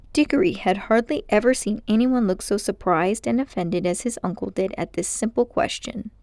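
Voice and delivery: ordinary delivery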